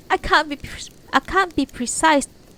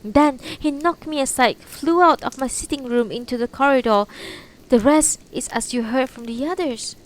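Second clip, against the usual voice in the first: frightened witness voice; 5 semitones higher, 6 dB louder than usual. Acted. Tamayo retells the scare to police.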